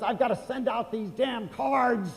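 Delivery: fed-up shopper listing her chores, mock fury; imitating furious shopper. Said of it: "Well, she was furious".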